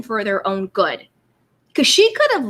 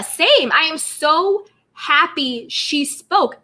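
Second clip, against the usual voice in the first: ordinary talk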